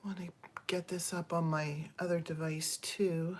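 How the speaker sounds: whisper. Real, not acted.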